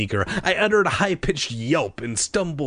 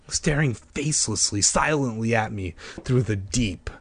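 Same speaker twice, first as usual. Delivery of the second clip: plain reading voice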